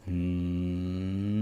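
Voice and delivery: long low soft hum; humming exercise. Shown as a demonstration. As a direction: hold a steady low hum. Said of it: "humming की exercise करनी है". (धीमी, लंबी गुनगुनाहट)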